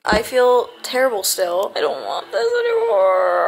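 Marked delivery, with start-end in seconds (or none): 1.8-3.5 much higher, strained, tearful; real tearful despair; breaking down. Real, not acted. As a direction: voice cracking in despair. Overwhelmed by medication side effects.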